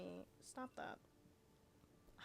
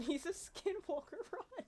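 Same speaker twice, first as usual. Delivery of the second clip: laughing; 7 semitones higher than usual. Real, not acted. Laughing, mock panic at NPC.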